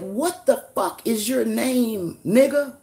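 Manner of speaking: slow, high, loud demand; retold demand. Streamer replaying his challenge to the troll, slow and loud. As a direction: drag out each word of the demand. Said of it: "I said".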